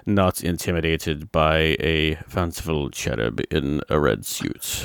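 slow, lightly British, grandly measured; a hint of a stately British villain, drawn out slowly; mock-grand British read of a pompous line, slowed right down; deep British delivery. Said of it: "this deep and very British, uh, delivery".

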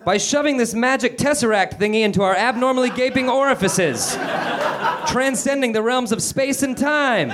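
high declamatory voice, no real volume; mock shout in unison. Meg and Calvin answering the harpies together, high mock shout. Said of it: "Meg and Calvin shouted in unison".

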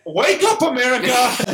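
high-pitched hollered wake-up call; mock rallying shout. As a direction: cry it out to a whole nation in mock outrage. Mock rallying cry at his friends.

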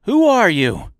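loud, high challenge; crying out. A narrator's reading. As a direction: shout out a demand. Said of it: "and cried out".

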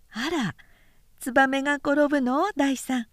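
a hint of a playful nurse. A narrator's reading. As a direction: gently humour the little boy. Nurse answers the child.

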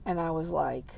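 normal talking voice

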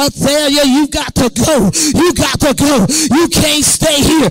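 firm spirit-binding command; commanding rebuke. Acted. Preacher orders the spirit Athaliah to leave, firm command.

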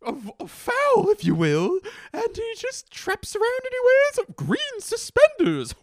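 squeaky cartoon-mascot falsetto, loud; loud, excited high-pitched rambling; absurdly high voice. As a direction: climb into a very high, silly character voice. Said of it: "Why is my voice this high?".

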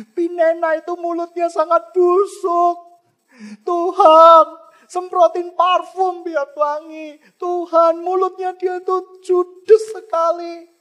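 Hana praying aloud against her rival, loud and high; loud complaining prayer. Pretend. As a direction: cry out to God so the rival hears. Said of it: "Tidak ngomong dengan kata-kata keras".

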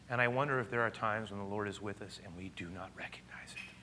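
soft, hushed, almost whispered wondering; hushed reflection. Real, not acted. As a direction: draw the congregation close in a near whisper. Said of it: "intimate hushed reflection".

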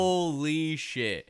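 loud, slow, high holy shit; surprised exclamation. Real, not acted. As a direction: let it out in shock. Reacting to outburst.

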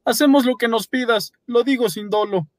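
high eager pleading; Paolo pleading. A narrator's reading. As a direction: promise anything to be spared. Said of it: "dijo Paolo".

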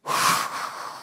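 exaggerated rush of breath; demonstrative big breath. Shown as a demonstration. Speaker interrupting Alan's meeting to breathe, exaggerated breath. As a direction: breathe out audibly to make the point. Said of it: "I've got to breathe".

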